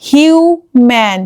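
হিউম্যান drawn out syllable by syllable; slow demo word. Shown as a demonstration. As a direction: teach হিউম্যান slowly. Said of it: "আর open সিলেবলে দেখো". (ধীরে ভেঙে হিউম্যান)

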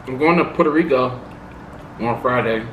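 chewing while talking; talking between bites; mouth full. Real, not acted. Seen 1: Puts forkful in mouth at 0.8–1.3s, keeps chewing while speaking.

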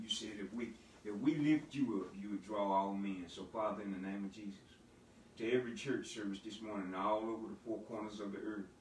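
soft, low, reverent prayer voice. Acted. Pastor opens Sunday service praying softly to God. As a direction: pray quietly and humbly to the Lord.

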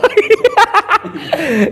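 high-pitched, drawn-out burst of laughter; real laughter. Real, not acted. Laughs while swearing to his account. (tertawa lepas melengking)

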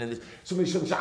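ordinary delivery

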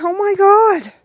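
high, loud delighted gasp of relief, the last words slowed; excited exclaiming. Retold or imitated. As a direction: burst out with joyful relief, then stretch out "it's Christmas.". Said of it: "I'm like".

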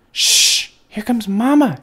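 sharp shush, then high little-bird hiss; high-pitched hissing baby bird; hissed warning. A narrator's reading. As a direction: hush the other chicks urgently. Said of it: "hissed Pip".

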